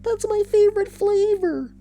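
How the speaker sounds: very high squeaky silly voice; squeaky cartoon voice. Squeaky character voice.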